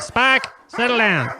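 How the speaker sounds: high, slow, loud scold; scolding a dog. Acted. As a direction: order the dog to settle.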